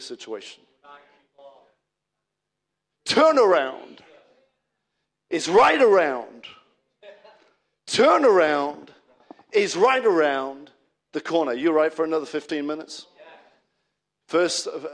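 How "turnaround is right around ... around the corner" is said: loud, drawn-out declaration; preacher's proclamation. Real, not acted. Preacher rallying the congregation with the turnaround promise, loud proclamation. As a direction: ring it out slowly to the whole church.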